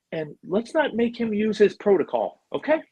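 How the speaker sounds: high-pitched movie star giving orders; Vince Vaughn. Retold or imitated. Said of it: "he just took over".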